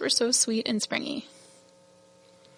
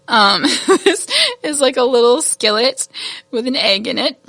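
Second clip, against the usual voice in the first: laughing; 3 semitones higher, 8 dB louder than usual. Real, not acted. Host showing a little skillet with an egg, laughing. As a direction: crack up while describing it, voice lifting. Amused by tiny skillet charm.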